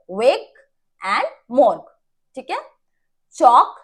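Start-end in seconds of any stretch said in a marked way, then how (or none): 3.3-3.7 चॉक with no l before k; silent l. Shown as a demonstration. Teacher giving the correct word, चॉक with silent l. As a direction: say चॉक as chaw-k. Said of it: "एल की sound नहीं आ रही".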